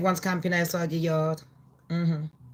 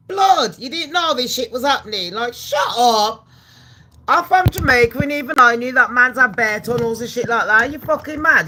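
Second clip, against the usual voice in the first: loud, high disbelieving rant; incredulous outburst; 4 semitones higher, 7 dB louder than usual. Retold or imitated. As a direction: scoff at them loudly, can't believe they didn't know. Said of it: "And I'm like".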